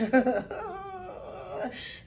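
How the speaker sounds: high mock sob; acted trembling cry sound; imitated crying. Shown as a demonstration. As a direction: break into a demonstrated whimpering wail. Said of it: "tears will start flowing very easily".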